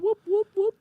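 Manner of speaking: high Curly-style whoop; Stooges whoop. Retold or imitated. Three Stooges imitation.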